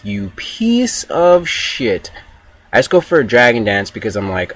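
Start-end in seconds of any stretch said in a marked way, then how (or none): 0.0-2.1 high, slow, dragged-out mock outrage; a hammed-up high whine of betrayal; mock outraged cry. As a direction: stretch each word in a high put-on wail. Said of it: "and I'm like".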